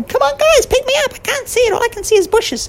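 very high squeaking plea; tiny mouse stuck in bushes; frantic little mouse squeak; squeaky mouse voice. Acted. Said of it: "the mouse was going".

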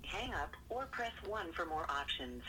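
quiet recorded phone prompt; automated voicemail message; phone menu voice. Acted. Voicemail greeting playing.

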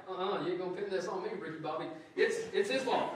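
quick, huffy denial; defensive healed man. Retold or imitated. Healed man refusing blame, a quick huffy denial. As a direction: brush off the blame fast. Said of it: "is like".

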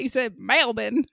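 You said Melbourne with a broad country Aussie MAYL-bn; mock country Australian Melbourne; mimicking country Australian accent. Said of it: "country Australian accent".

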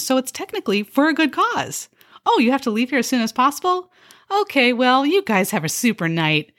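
high, chirpy server voice with a snide edge; a fake-cheerful waitress needling her customers; perky sarcastic waitress. A waitress sweetly insulting her diners, chirpy and high.

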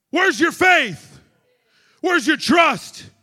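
slow, loud and high preaching; preaching shout. Acted. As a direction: call it out to the church. Preaching at full force.

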